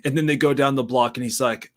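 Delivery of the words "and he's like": normal talking voice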